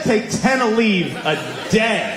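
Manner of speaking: high-pitched, drawn-out disbelief; incredulous. Retold or imitated. Comic repeats doctor's absurd advice to the crowd, incredulous.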